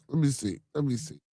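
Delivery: ordinary delivery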